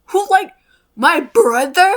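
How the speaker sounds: high, loud shocked squeal; shocked disbelief. Retold or imitated. Realising it's her brother's post, a high shocked squeal. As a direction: squeal it in horror. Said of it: "and I was like".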